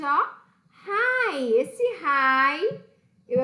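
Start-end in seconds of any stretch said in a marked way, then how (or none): 0.9-1.5 Hi said as "rai", breathy h-sound and long ai; Hi with h like English h. Shown as a demonstration. Teacher showing students how Hi is pronounced, breathy h then ai. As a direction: say Hi with the h blown out like a Brazilian R, then ai. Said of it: "H tem som de R e o I tem som de I".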